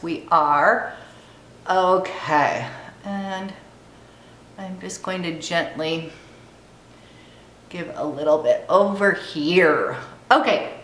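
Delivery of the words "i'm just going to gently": usual speaking voice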